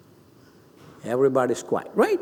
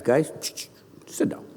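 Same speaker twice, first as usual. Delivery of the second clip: soft, low hushed instruction; quiet command; 5 semitones lower, 7 dB quieter than usual. Acted. Leader settles the guys in church, soft hushed order. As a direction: hush the group almost in a whisper. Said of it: "Like I would say to the guys".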